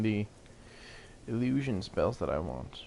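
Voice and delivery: usual speaking voice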